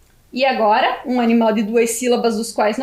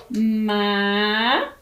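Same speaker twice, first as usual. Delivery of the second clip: MACA drawn out, lower, with a long first syllable; sounded-out syllables; 4 semitones lower than usual. Shown as a demonstration. Teacher spells for pupils, stretching each syllable. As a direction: hold the MA of MACA, then place CA.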